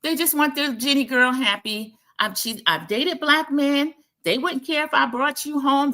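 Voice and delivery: imitating Jenny. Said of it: "She says".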